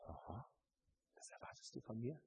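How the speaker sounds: quiet voice of God. Retold or imitated. Preacher voicing God answering the believer, very soft. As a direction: hush down as God replies.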